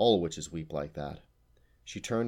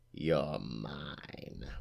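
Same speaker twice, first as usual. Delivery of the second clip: low, softer hiss of ownership; menacing whisper; 4 semitones lower, 6 dB quieter than usual. A narrator's reading. Witch claiming the harpy, whispered menace. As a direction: drop it to a breathless threat.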